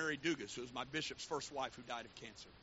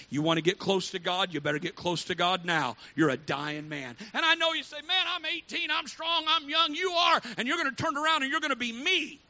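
put-on louder, driving sermon build to the young crowd; preaching at full force; 8 semitones higher, 10 dB louder than usual. Preacher warning young adults they will age, preaching hard. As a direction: press it on them like a warning.

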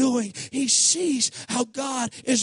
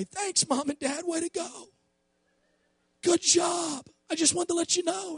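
mock grateful newborn; 8 semitones higher than usual. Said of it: "they didn't come out and say".

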